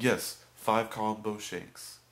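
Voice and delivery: put-on loud, slow French-accented insistence; imitated thick French accent, drawn out. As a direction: mimic a French customer insisting loudly. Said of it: "he had a thick accent".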